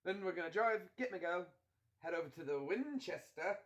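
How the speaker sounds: brisk British film-hero voice; put-on British accent. Friend laying out a rescue plan, British accent.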